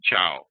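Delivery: "ciao" with the ch of reach; Italian ch sound. Shown as a demonstration. Teacher giving learners an Italian example, ch sound without breath. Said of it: "pronounced like the ch in reach without breath".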